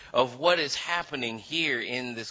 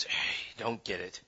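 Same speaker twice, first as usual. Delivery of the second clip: much lower and softer, half to himself; mumbled aside; 7 semitones lower, 9 dB quieter than usual. Retold or imitated. Jesus shaking his head at Nicodemus, low muttered aside. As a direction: shrug it off under your breath. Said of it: "shakes his head and goes".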